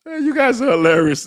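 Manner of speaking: high, loud laugh-filled cry of delight. Real, not acted. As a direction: still cracking up as you say it. Laughing at chat comments.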